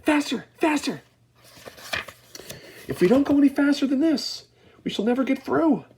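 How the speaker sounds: high, loud urging cry; cried out. A narrator's reading. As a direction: egg the lift on, shouting. Wonka urging the lift.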